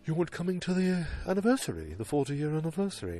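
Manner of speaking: high-pitched caricature Brit; mock British accent. Lane speaking to his boss, high mock British accent. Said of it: "Lane goes to Bert Cooper's office".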